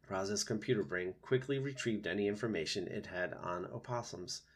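ordinary delivery, nothing marked

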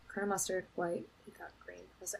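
very quiet, fading recount; half-voiced private tally; counting to self. Real, not acted. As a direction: count off names under your breath. Counting the names to herself.

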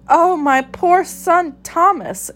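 mother cat lamenting her missing son; anguished exclaim. A narrator's reading. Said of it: "exclaimed Tabitha".

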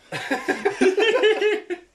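high, loud burst of laughter. Real, not acted. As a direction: cackle out loud at the moment.

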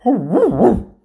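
high, loud woofing; loud imitated dog barks. Buster the dog working his magic, loud high barking. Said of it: "the dog barked".

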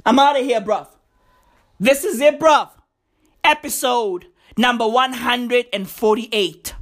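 ordinary talk

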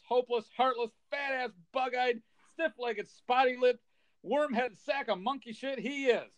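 high, furious ranting rant; unhinged escalating insult tirade; Clark Griswold tirade. Retold or imitated. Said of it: "sends him on this tirade where he says".

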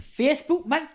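playful Geordie imitation of "Facebook, man"; Geordie accent. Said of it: "read out in a Geordie accent".

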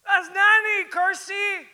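high, very loud incredulous shout; exasperated yell. Retold or imitated. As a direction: shout it at someone who doesn't get it. Exasperated fan answers indifferent companion.

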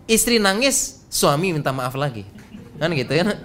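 ordinary talk, nothing marked

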